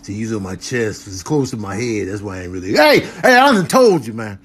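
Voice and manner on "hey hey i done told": high, loud hurried hey; sharp protest. Real, not acted. Telling the masseur off the body, loud. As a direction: yell at them to stop. Yelling at massager again.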